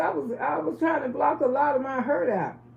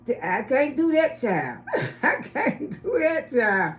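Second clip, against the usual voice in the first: effeminate homeboy; slower than usual. Retold or imitated. Homeboy refusing to tell Loretta what he knows, imitated effeminate voice. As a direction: playfully refuse in his voice, with a laugh. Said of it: "this is the way the guy used to talk".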